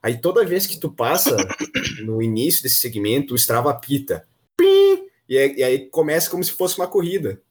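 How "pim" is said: sharp, very high beep sound; imitated app beep. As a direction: chirp it like a phone alert. (bipe agudo imitado)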